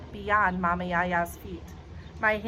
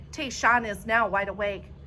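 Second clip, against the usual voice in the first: ordinary delivery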